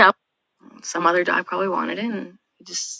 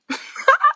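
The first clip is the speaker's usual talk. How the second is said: sharp high giggle; a quick high laugh. Real, not acted. Laughs at vet's report.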